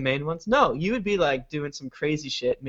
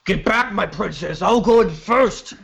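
heroic knight voice; 4 semitones higher than usual. Acted. Heroic character charging ahead.

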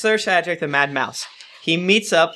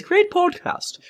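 put-on British accent; 6 semitones higher than usual. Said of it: "That was a good accent".

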